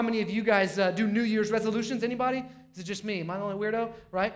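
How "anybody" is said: ordinary delivery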